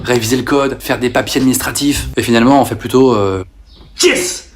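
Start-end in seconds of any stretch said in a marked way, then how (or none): 4.0-4.5 sudden high yelp, then slow; excited cheer. Acted. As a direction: celebrate a tiny win. Excited over jigsaw puzzle. (joie soudaine et aiguë)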